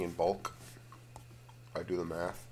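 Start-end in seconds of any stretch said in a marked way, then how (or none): none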